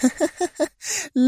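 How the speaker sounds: very high, soft teasing laugh; playful villainess laugh. A narrator's reading. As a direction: laugh mischievously at his remark. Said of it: "أطلقت الزعيمة ضحكة عابثة أخرى". (ضحكة عابثة عالية الطبقة)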